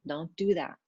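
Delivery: usual speaking voice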